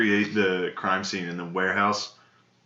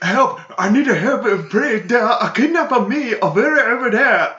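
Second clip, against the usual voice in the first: panicked broken English; 13 semitones higher, 6 dB louder than usual. Retold or imitated. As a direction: scream for help in a hammed-up accent. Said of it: "he's like".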